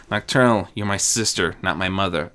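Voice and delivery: ordinary delivery, nothing marked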